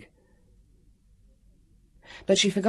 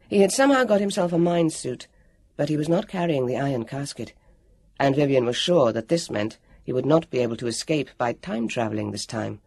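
ordinary delivery

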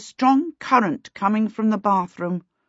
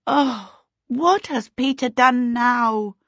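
higher, slow, dismayed cry; dismayed exclamation; 6 semitones higher, slower than usual. A narrator's reading. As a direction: voice her sinking shock at the mess. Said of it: "Charmaine exclaimed".

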